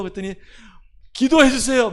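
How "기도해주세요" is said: high, loud imitated plea; loud mock request. Contractor suddenly asking for prayer, imitated high and loud.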